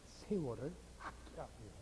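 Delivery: low, quiet quote of the verse; hushed reminder. Real, not acted. Preacher reminds congregation of the earlier verse, hushed. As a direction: bring it right down, low and soft.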